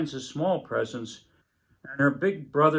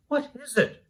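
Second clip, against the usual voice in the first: put-on high, sleepy little girl voice; waking little girl; 11 semitones higher than usual. A narrator's reading. Little girl woken by her siblings, high and puzzled. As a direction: rub your eyes and ask, high and puzzled. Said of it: "said the little girl".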